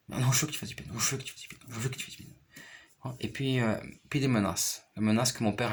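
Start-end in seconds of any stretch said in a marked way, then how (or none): 0.1-2.4 imitating a nagging mother in a hushed, half-voiced repeat; retold insistence. Mother pushing her child to take piano, hushed retold insistence.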